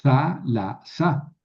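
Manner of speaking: thalassa broken slowly into THA-la-sa; stress on first syllable. Shown as a demonstration. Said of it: "proparoxítona". (thalassa sílaba por sílaba, con acento en la primera)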